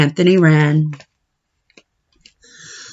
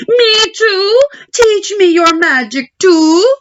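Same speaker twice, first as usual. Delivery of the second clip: much higher, eager begging; eager plea; 13 semitones higher, 3 dB louder than usual. Acted. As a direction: beg like an excited overgrown boy. Anthony begs to learn magic.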